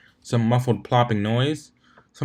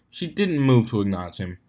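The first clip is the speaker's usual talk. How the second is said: ordinary delivery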